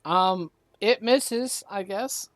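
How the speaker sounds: slow, deflated game master ruling; disappointed. Real, not acted. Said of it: "[name] sounds so disappointed right now".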